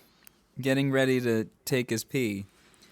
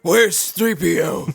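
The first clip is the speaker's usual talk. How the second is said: higher, loud, slow gravel-voiced yell; Batman yell; 7 semitones higher, 7 dB louder, slower than usual. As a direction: bellow each question like Batman. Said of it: "just yelling".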